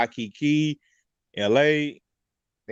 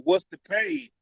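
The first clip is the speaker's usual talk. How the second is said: repeated louder; 12 semitones higher, slower than usual. Real, not acted. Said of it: "I said".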